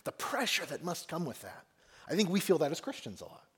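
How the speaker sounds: normal talking voice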